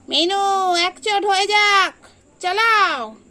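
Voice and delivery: loud high cheer to start the fight; egging on. Real, not acted. Owner cheering her cat Minu into a fight. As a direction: urge the cats on, fired up.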